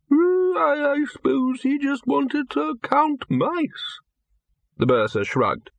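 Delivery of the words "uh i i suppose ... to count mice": put-on old man voice. A narrator's reading. Old wizard Windlepoons voiced.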